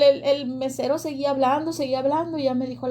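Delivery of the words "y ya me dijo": normal talking voice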